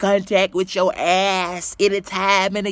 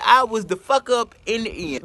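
usual speaking voice

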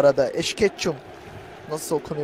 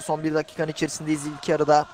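usual speaking voice

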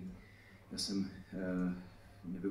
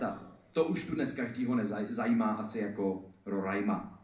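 lively, higher, projected rhyming delivery; slam poetry recital; 6 semitones higher, 8 dB louder than usual. Acted. Poet performing satire on Prague roadworks to an audience, slam poetry recital. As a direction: perform it as a driving slam poem.

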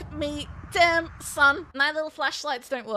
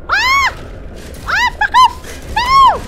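very high, loud panicked shout; panicked scream; 9 dB louder than usual. Real, not acted. Attacked in the game.

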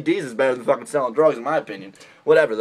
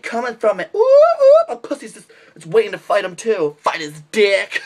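much higher, hammed-up swooning girl; mock swooning girl; 6 semitones higher than usual. Mocking a girl swooning over a tough guy, high gushing. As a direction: gush over him like a lovestruck groupie. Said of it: "No one's like".

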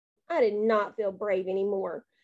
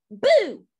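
loud, higher BOO; shouted; 8 semitones higher, 6 dB louder than usual. A narrator's reading. Kid clown jumping at trick-or-treaters, shouting. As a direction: shout it to frighten. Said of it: "I shouted".

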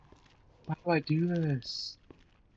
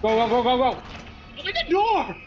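frantic yelling; 11 semitones higher than usual. Real, not acted. Escaping the monster, she screams at the door.

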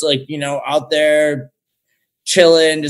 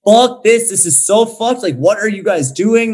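retold outrage; 7 semitones higher, 5 dB louder than usual. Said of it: "I was just like".

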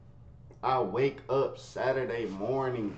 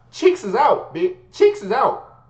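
excited; 7 semitones higher, 9 dB louder, slower than usual. Real, not acted. Emphatic comic retelling.

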